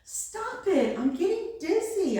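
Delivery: high-pitched, dizzy protest; mild storybook scream. A narrator's reading. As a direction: cry out high without full volume. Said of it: "I screamed".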